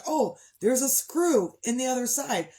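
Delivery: realizing discovery. Retold or imitated. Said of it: "I was like".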